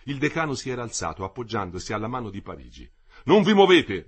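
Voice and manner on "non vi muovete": high, loud command; shouted order. A narrator's reading. The delegate shouts at the advancing workers, shouted order. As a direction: freeze them with a yell. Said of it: "gridò il delegato".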